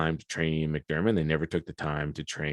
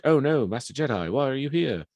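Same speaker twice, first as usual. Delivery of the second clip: high, mock-innocent Palpatine voice, pitched up; playing innocent; 8 semitones higher, 4 dB louder than usual. Palpatine greets the arresting Jedi, acting falsely innocent. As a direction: feign surprise like an innocent old man. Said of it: "So he kind of plays innocent".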